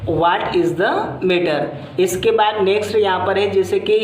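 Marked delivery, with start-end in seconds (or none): 0.1-1.6 "What is the matter?" slow, "matter" dropping; slow falling tone. Shown as a demonstration. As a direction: say it slowly with voice falling at the end. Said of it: "एक question discuss किया था". (धीमे से, अंत में आवाज़ गिराकर)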